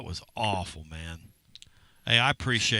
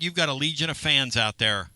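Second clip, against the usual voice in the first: ordinary talk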